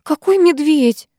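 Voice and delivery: high, drawn-out dismissal; dismissive. A narrator's reading. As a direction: brush off a silly question. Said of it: "отмахнулась Викуля".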